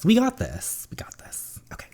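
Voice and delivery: hushed, whispered self-encouragement; whispered pep talk. Acted. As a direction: reassure under your breath, dropping away.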